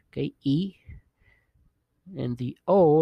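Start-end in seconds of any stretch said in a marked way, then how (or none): 0.4-0.7 E said as a long "ee"; ee as in sheep. Shown as a demonstration. Teacher likening it to a double E, long "ee". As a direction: say E like the vowel in "sheep". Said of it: "like you're saying when you say sheep".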